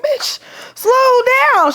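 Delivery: high, slow, drawn-out yell at the nurse; panicked yell. Retold or imitated. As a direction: scream it out as she races you down the hall. Rushed in wheelchair, yelling.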